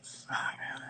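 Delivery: a hushed, breathless curse under the breath; whispered swear of worry. Retold or imitated. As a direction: mutter it to yourself so nobody hears. Inner thought while waiting nervously.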